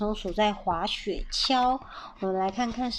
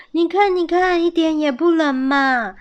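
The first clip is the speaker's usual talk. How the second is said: high, loud cheerful persuading; eager coaxing; 6 semitones higher, 5 dB louder than usual. A narrator's reading. Squirrel dad talking mom into playing, eager coaxing. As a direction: persuade brightly, voice raised.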